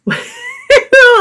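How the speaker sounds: high, exasperated burst of laughter. Real, not acted. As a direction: laugh it off in disbelief. Laughing at own Costco frustration.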